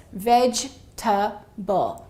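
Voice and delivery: 'vegetable' broken apart with pauses, second e dropped; slow syllable breakdown. Shown as a demonstration. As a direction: say 'vegetable' slowly in three beats, VEJ, tuh, bul. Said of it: "it's gonna sound like this".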